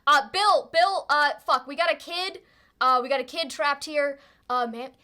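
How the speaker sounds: man hollering to a mate in an emergency; urgent shouting. Acted. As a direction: shout for Bill in alarm. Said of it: "as this guy shouts".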